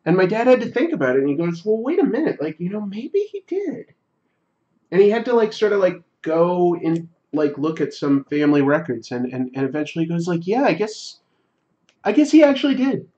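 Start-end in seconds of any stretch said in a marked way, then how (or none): 1.6-3.9 higher, reconsidering dad voice; a hint of a thoughtful father; pondering dad. 10.5-13.1 much higher, quick astonished concession; dad realising the family records prove it; surprised admission. Retold or imitated.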